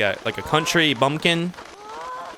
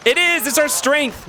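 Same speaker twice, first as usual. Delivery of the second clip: much higher, excited exclaim; thrilled discovery; 11 semitones higher than usual. Real, not acted. Streamer finding the strength upgrade, thrilled high cry. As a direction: burst out with delight.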